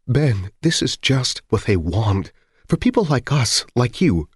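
acted tearful wife; tearful pleading. A narrator's reading. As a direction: plead with him, on the edge of tears. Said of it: "hardly coherent through her tears".

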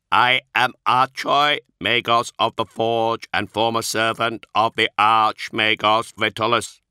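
slow, measured, strangely inflected machine voice; a polite machine reciting its excuses; synthetic Magos voice. Acted. The Magos explaining to the Astartes, a slow synthetic voice. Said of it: "Archoi's synthesized voice".

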